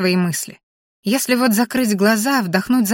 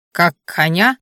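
ordinary delivery, nothing marked

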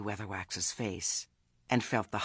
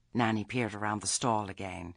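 ordinary delivery

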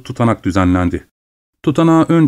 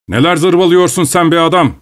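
raised, higher scornful voice; angry retort; 6 semitones higher than usual. Acted. Overseer scorning the woodcutter's answer, raised and angry. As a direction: throw the insult back.

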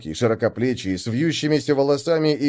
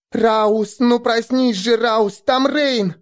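excited; 10 semitones higher, 4 dB louder, much slower than usual. Acted. Girl wakes him excitedly.

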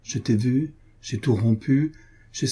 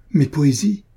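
stunned exclamation, higher and louder; astonished cry; 5 semitones higher, 5 dB louder than usual. A narrator's reading. Poet finding his poems in her book, astonished. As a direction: cry out in amazement. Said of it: "s'écria-t-il, stupéfié".

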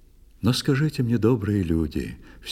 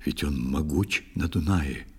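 a husband's quiet aside to his wife, lower and soft; hushed warning; 5 semitones lower, 6 dB quieter than usual. A narrator's reading. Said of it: "шепнул он".

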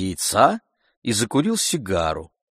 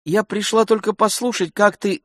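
high-pitched woman servant answering back; maid's voice; 12 semitones higher, 3 dB louder than usual. A narrator's reading. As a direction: lift the voice high for the maid. Maid answers the angry servant.

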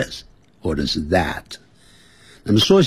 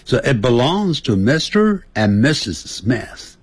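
慢速示范; 3 semitones higher than usual. Shown as a demonstration. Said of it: "当然你可以念慢一点".

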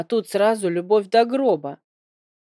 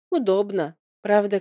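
ordinary delivery

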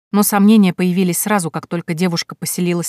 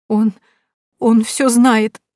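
higher, slow, tearful and broken; sobbing; 5 semitones higher, 5 dB louder, much slower than usual. A narrator's reading. Elizaveta sobs to Nikolya that the count knows, sobbing. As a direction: choke it out through tears. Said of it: "всхлипнула Елизавета".

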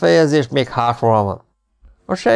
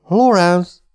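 louder, higher shout of the name; roaring; 8 semitones higher, 4 dB louder than usual. A narrator's reading. The marquis shaking the sheikh awake, shouting loud. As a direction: bellow the name to shake him awake. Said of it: "ordította".

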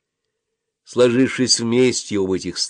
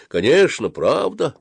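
usual speaking voice

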